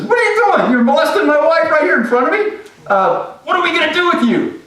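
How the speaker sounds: very high, loud outraged yell; furious king. Acted. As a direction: roar at the man on the couch. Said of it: "And he says".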